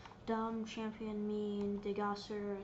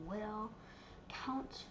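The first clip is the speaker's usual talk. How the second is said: ordinary delivery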